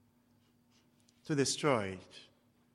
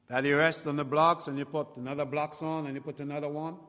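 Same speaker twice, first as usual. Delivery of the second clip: ordinary delivery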